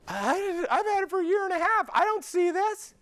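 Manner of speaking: amazed chiropractor voice. Retold or imitated. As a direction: sound astonished and a bit envious. Rival device owner voiced amazed.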